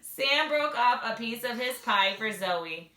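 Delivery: usual speaking voice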